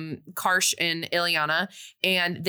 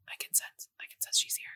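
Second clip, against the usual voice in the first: hushed eerie whisper; 10 dB quieter than usual. Retold or imitated. The mom senses Alex hiding, eerie whisper. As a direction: breathe it out, no voice.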